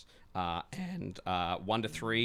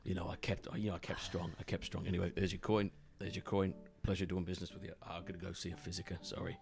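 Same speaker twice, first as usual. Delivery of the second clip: shaken Decker; 6 dB quieter than usual. Acted. Beaten-up Decker reporting to the gang, shaken and subdued. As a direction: softly stammer it out, repeating yourself. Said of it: "he comes on in and he goes".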